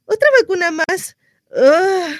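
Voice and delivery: high, tearful wail over another shot; whiny sob. Acted. Distraught mother wailing over baby's vaccine, tearful.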